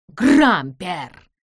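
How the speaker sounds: loud, drawn-out exclamation of a name; exclaimed. A narrator's reading. Amos spots his rival in the cave, exclaiming. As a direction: cry out the name, falling off. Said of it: "воскликнул он".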